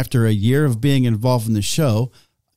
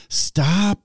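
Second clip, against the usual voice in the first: put-on aggravated kid; 5 semitones higher than usual. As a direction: stop rising in two syllables, whiny. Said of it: "if they're aggravated with you".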